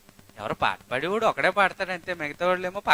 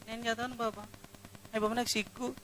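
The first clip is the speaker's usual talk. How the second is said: shy mock refusal; 4 semitones higher, 16 dB quieter than usual. Shy congregant refusing to read.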